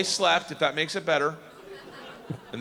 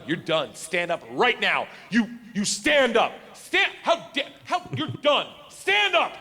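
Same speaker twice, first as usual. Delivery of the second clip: hammed-up furious chaperone; mock angry teacher; 3 semitones higher than usual. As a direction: bark orders at the kids.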